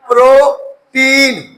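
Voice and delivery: put-on protein split slowly as "pro... teen"; syllable by syllable. Shown as a demonstration. Teacher sounding out an example for students, protein slowly in two parts.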